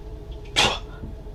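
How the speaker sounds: sharp unvoiced hock before spitting; hawking spit sound. Marine about to spit in the guy's face, harsh hocking sound. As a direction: gather spit with a harsh breath.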